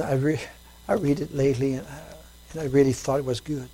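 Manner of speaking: normal talking voice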